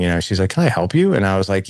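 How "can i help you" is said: much higher, sweet and gentle; sweet loving woman. Retold or imitated. Kind woman greeting a curious boy, sweetly higher. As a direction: ask it kindly, like a sweet stranger. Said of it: "She's a very sweet woman, very loving".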